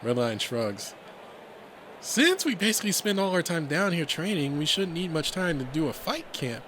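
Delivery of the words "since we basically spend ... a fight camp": friendly explainer. Acted. Redline explaining training to newcomer.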